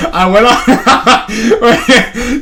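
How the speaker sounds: helpless laughter stretching the words; laughing. Real, not acted. Storyteller confessing the lunch break escape, laughing hard. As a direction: try to speak while cracking up.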